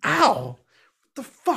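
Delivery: high, startled yelp of protest; pained yelp. Acted. Player yelps as teacher shoves the character, pained outburst. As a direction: cry out as if just shoved.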